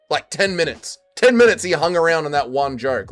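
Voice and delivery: ordinary talk, nothing marked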